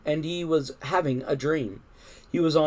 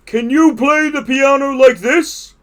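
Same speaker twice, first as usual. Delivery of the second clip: high, loud showing-off question; boastful cry; 10 semitones higher, 10 dB louder than usual. A narrator's reading. Toad shows off his piano playing to Frog, loud boast. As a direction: challenge a friend loudly from the stage. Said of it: "cried Toad".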